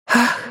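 high final gasp; a last exhaled cry; last breath. A narrator's reading. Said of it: "донёсся последний выдох".

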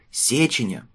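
confident shout, falling at the end; firmly name the sender; boy courier voice. A narrator's reading. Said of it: "крикнул мальчишка-курьер". (мальчишка-курьер уверенно выкрикивает)

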